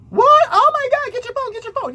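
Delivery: high-pitched excited yelp; mock frantic onlookers. Bystanders rushing for phones, mock frantic excitement. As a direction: shriek it like a gawking bystander.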